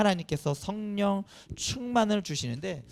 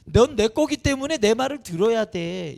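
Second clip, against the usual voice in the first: loud, raised-pitch parental insistence; possessive parent; 4 semitones higher, 6 dB louder than usual. Acted. Parent claiming ownership of a child, mock insistence. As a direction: lay down the law as a parent. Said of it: "내 소유라는 생각이".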